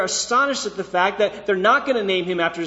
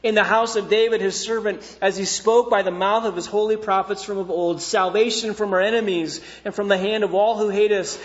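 high, loud, ringing declaration of praise; full-force scripture proclamation; 3 semitones higher, 5 dB louder than usual. Acted. Preacher reads Zechariah's praise to the congregation, loud and exultant. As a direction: proclaim it boldly to a whole congregation.

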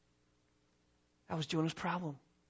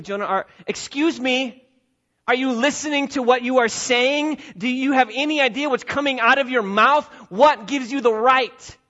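loud, higher scolding questions; stern rebuke; 5 semitones higher, 6 dB louder than usual. Acted. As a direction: confront him with his own words. God rebuking Jonah.

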